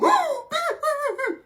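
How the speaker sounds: sharp, very high gasp of fright; startled gasp on seeing Billy; shocked high gasp. Acted. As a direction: gasp in sudden fear.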